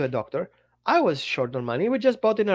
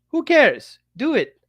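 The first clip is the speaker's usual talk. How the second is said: loud, higher, curt order; bossy boss; 8 semitones higher, 7 dB louder, slower than usual. Retold or imitated. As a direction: bark it like a pushy boss. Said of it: "people told you".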